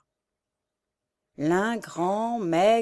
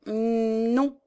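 higher, drawn-out hesitant no; stammered refusal; 4 semitones higher than usual. A narrator's reading. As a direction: falter on the one word. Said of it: "balbutia Michel". (refus balbutié, hésitant)

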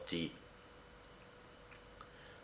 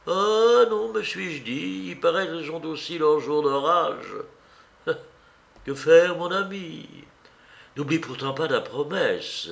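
put-on higher-pitched, animated old lady; elderly woman's expressive, raised voice; kindly insistent old aunt; 5 semitones higher than usual. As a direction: counsel a nephew as a lively aunt. Old aunt bidding farewell.

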